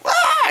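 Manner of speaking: very high brief screech; imitated monster shriek; mock screech. Devil girl lunging at her rescuers, mock screech.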